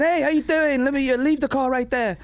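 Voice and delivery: high-pitched put-on British accent; hammed-up British voice, high and bright-eyed. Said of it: "I switched to the British accent".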